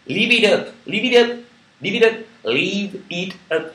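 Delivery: loud, high, drawn-out French-accented English; showy English accent. Shown as a demonstration. Card reader repeating the card's English motto, loud French-accented English. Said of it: "mon accent est génial".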